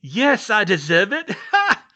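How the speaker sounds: loud, high yell breaking into laughter; mad laughing yell. Acted. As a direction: yell it grinning like a madman. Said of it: "Crouch yelled, grinning madly".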